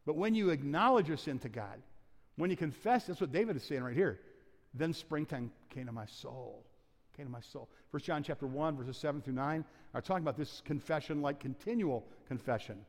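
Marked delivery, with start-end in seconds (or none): 4.7-7.7 soft echo. Acted. Pastor softly echoing David's words to the congregation, hushed. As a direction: let the line fall away to a near hush.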